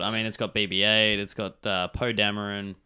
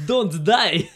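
high, loud mock impression; Christopher Walken; 7 semitones higher, 5 dB louder than usual. Friend joining in as Walken kid at school, hammed-up. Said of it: "like, a Christopher Walken accent".

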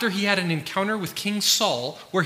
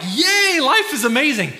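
much higher, louder mock "yay"; mock cheering; 9 semitones higher, 7 dB louder than usual. Pastor mocking a privileged nation, high loud ironic cheer. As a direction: cheer like everything is perfect, half ironic. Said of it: "You'd think we'd be like".

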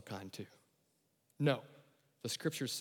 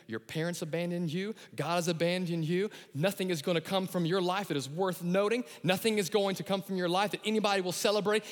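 ordinary talk

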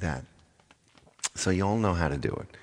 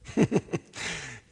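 high giggle. Real, not acted. Laugh after joke.

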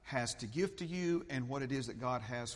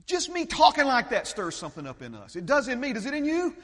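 loud, high, fast-driving preacher; stirred-up sermon voice, pitched high; fired-up preaching; 10 semitones higher, 13 dB louder, faster than usual. Real, not acted. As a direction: burst into impassioned preaching at full voice. Preaches at full force.

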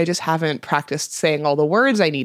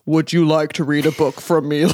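low put-on character voice; showing off a voice; 4 semitones lower than usual. Shown as a demonstration. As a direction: drop low and pitch yourself as a narrator. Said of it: "like this?".

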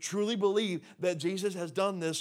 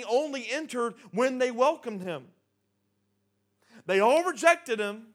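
preaching climax; 5 semitones higher, 6 dB louder than usual. Real, not acted. Preacher to congregation, building to a loud climax. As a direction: hammer home the rejection.

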